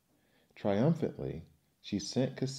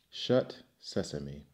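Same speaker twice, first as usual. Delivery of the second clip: slow command; 4 semitones lower, slower than usual. Acted. Thief captain commands the rock.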